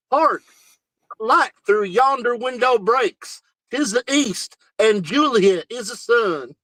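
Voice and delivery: hillbilly Shakespeare. Retold or imitated. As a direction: ham up an Alabama drawl on Romeo's lines. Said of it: "Alabama hillbillies going like".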